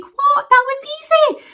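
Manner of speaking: a delighted shriek of disbelief; amazed disbelief. Retold or imitated. Reaching the top of the stairs, amazed squeal. Shocked at easy stair climb.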